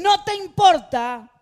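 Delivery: high, loud, slow plea; desperate cry. Acted. Disciples crying out to Jesus in the storm, desperate and loud. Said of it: "fue un grito desesperado".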